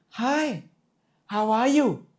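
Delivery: very high, comforting greeting; high-pitched, comforting. Shown as a demonstration. Coach demonstrating tone, high-pitched and comforting. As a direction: greet them high and soothing. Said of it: "this is high pitched and comforting tone".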